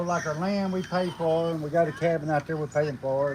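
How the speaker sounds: normal talking voice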